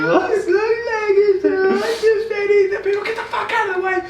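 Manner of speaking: high, wailing fake cry, drawn out; mock sobbing. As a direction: blubber it like a hammed-up mourner. Mock mourning for Lagertha's death.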